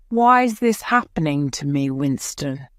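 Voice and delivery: usual speaking voice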